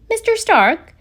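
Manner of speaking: a hint of a keen kid calling his mentor; eager teen.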